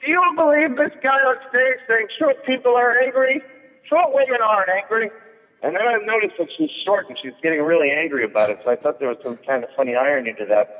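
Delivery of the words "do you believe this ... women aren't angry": high, outraged waitress voice; waitress griping to the bartender for everyone to hear, high and outraged; loud indignant waitress. Retold or imitated. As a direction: complain high enough for the whole room. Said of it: "so that everybody could hear it".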